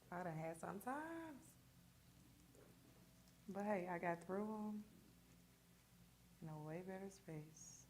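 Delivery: normal talking voice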